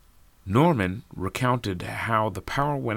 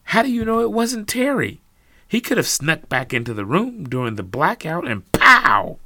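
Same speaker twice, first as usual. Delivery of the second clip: heated accusation; 10 semitones higher, 7 dB louder than usual. Acted. Lisa accuses Terry.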